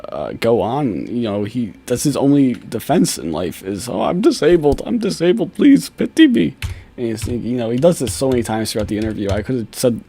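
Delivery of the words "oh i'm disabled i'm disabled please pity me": mock pleading whine. Vlogger mocking the suspect begging for pity, whining higher.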